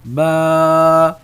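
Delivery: با held long and loud with a heavy sound. Shown as a demonstration. Teacher recites the letter row to students, loud and held. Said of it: "اب جیسے پڑھا ہم نے".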